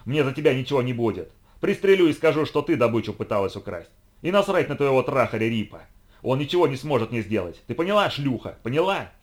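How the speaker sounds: furious shouting. Acted. Enraged hunter threatens pinned girl.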